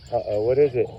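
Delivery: ordinary talk, nothing marked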